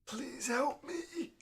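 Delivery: put-on high, slow frail old lady plea; frail shaky old whisper. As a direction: plead weakly like an old woman.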